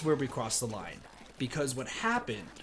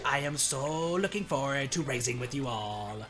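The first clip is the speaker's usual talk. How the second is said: higher, much louder hammed-up flamboyant Waluigi; 3 semitones higher, 6 dB louder than usual. Streamer reenacting his game-night Waluigi bit, loud flamboyant caricature.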